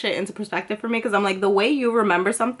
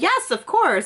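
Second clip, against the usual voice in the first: higher, gushing mock agreement; mock eager yes; 7 semitones higher, slower than usual. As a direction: parody his version of her eager reply, pitched up. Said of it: "I don't even talk like that".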